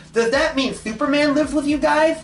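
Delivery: high, eager, bouncing question; excited question. A narrator's reading. Ed asking the girls about Superman, excited and eager. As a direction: ask it like a thrilled kid. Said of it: "Ed asked in an excited manner".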